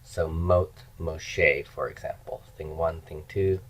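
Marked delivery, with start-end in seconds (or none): 0.0-1.6 mot Moshe drawn out slowly and softer; teacher slowly modelling mot Moshe; slow careful demo. Shown as a demonstration. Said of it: "the death of Moses".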